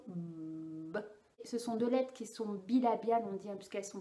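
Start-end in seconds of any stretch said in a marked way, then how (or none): 0.1-1.0 "B" held long, a buzzing voiced b between closed lips. Shown as a demonstration. Speech therapist to parent, a long humming b. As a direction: hum the "b" through closed lips so the lips vibrate. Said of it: "pour qu'il sente bien la vibration".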